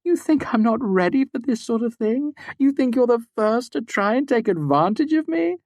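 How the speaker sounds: put-on high, defiant voice of a young woman. A narrator's reading. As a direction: fling it at him defiantly. Devi's defiant voice.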